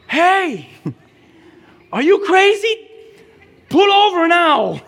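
very high, loud shout breaking into laughter; Holy Spirit yelling at the wayward driver, laughing shout; mock alarmed shout. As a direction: yell from the back seat with a laugh. Holy Spirit shouting from back seat.

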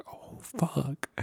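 very soft, sinking oh-no; hushed dismay. Retold or imitated. Student realising they broke the teacher, hushed dismay. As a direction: say it under your breath.

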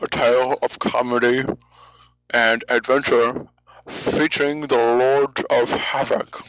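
lightly put-on show announcer; radio voice. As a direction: introduce the show like a radio host. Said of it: "be gone with that, uh, radio voice".

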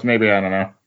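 usual speaking voice

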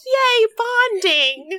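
high, slow gleeful cheer; drawn-out cheer. Acted. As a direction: stretch it out high, like a delighted mother. Mercy cheering on two others.

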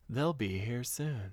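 sing-songy. Retold or imitated. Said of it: "in a sing-songy voice".

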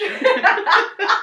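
shrieking burst of laughter; cracking up loudly; big high laugh. Real, not acted. Laughs at own reading.

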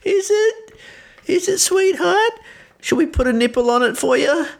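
high, patronising coo; baby talk mockery. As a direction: talk down like to a toddler. Teasing friend like a baby.

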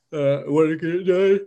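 words stretched through a yawn. Real, not acted. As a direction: talk mid-yawn, sleepy. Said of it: "I'm yawning so much today".

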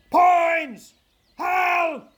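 high, very loud shout. Acted. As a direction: yell across the hillside. Falstaff calling out alone.

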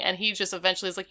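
ordinary talk, nothing marked

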